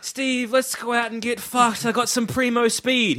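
high, eager party invite; hammed-up party pitch. Friend trying to tempt Captain America out, high eager mock invite. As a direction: pitch it like a dodgy party mate. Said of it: "I'm like".

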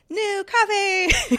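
high, slow, drawn-out cheer; gleeful exclamation. Retold or imitated. Host retelling a sore morning rescued by coffee, gleeful cheer. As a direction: stretch it out in mock rapture.

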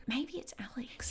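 hushed worried whisper. Acted. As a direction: whisper so nobody hears you. Hiding, fearing an intruder.

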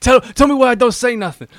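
high, loud film-gangster demand; mock shouted demand. Film spoof confronting a henchman, mock shouted demand. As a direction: shout it with laughter behind it.

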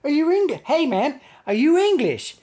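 high-pitched imitation of an eager stranger; excited American tourist. Imagined American turning to a British tourist, high and eager. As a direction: pitch it up, mock-impressed and curious. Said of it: "one of them turned around and said".

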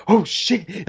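high, stunned exclamation; awed oh shit. Retold or imitated. Reacting to the booming sound, high stunned exclamation. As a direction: blurt it out, blown away. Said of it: "you're like".

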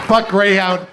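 reading the whole email body as a loud yell; blurted email. Shown as a demonstration. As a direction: fire it off as a yell. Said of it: "It's just".

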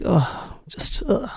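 a breathy, defeated "ugh" whispered out; deflated whisper. Pretend. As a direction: sigh it out like you've given up. Mimics slumped mood.

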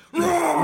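high monster roar; hammed-up Bigfoot roar; terrifying roar. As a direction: roar like a beast at the baseline. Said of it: "He makes a terrifying roar".

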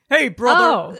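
a hint of Hulk Hogan. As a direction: growl it like a pro wrestler. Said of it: "Hulk Hogan".

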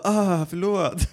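high, drawn-out pretend sorry; mock apology. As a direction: stretch out a put-on apology very slowly. Imagined drawn-out apology, acted. (utdragen låtsad ursäkt)

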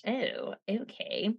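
slow, whispered "Oh, okay."; a hushed, wary whisper; mock whisper. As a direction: mutter it under your breath, slowly. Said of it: "I was just like".

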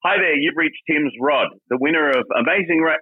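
high, bright answering-machine announcer voice; first take of a cheery voicemail greeting, rushed. Pretend. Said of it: "record our voicemail".

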